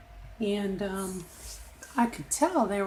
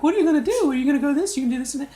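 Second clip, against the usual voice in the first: high, louder pushy questions; mimicking nagging people; 5 semitones higher, 5 dB louder, much faster than usual. Imitating people who pester her about her time off, high and loud. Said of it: "people are like".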